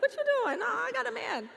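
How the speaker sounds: high-pitched, hammed-up coy brush-off; mock coy. Joking about newspaper sliding into her DMs, mock coy. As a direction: playfully fend off a suitor. Acts coy reply to messages.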